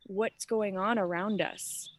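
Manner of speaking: usual speaking voice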